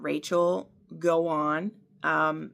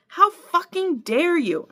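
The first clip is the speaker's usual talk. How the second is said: furious shout; 13 semitones higher, 5 dB louder than usual. Acted. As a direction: shout it in outrage. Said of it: "I shouted".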